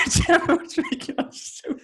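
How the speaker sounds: high, squeaky laugh-talk; helpless laughter pushing the voice way up; laughing while talking. Real, not acted. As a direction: crack up through the words. Cracking up over a shared memory.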